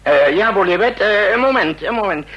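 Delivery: hoger en luider, gejaagd aan de telefoon; haastig luider. Acted. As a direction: roep het gehaast in de hoorn. (gejaagd en luid: even wachten)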